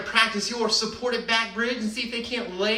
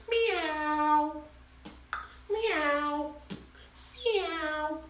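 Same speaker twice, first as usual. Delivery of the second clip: high, soft meows; playful cat meow; 8 semitones higher, 7 dB quieter, much slower than usual. Pretend. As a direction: meow like a sweet kitten. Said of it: "as we meow".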